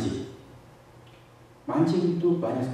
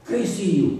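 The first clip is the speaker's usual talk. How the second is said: imitated angry retort; 8 semitones higher, 4 dB louder than usual. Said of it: "Lalu dia marah-marah".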